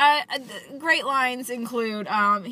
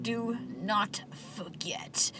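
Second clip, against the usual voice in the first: very slow and softer, each word spaced out; 9 dB quieter, slower than usual. Retold or imitated. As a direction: put a full stop after every word. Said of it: "like there's a period after every letter".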